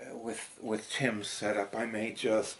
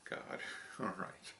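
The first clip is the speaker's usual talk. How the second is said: normal talking voice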